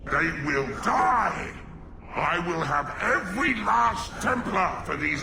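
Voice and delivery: furious shout. Acted. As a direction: roar a threat of vengeance. Possessed mage threatens templars.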